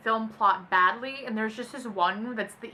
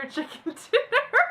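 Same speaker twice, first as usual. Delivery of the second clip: very high, loud laughing squeal; squealing laughter; 13 semitones higher, 5 dB louder than usual. Real, not acted. Reading the God Fieri tweet, squealing with laughter.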